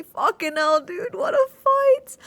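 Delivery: high, loud, teary exclamation; emotional outburst. Real, not acted. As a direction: burst out, choked with feeling. Reliving the victory emotionally.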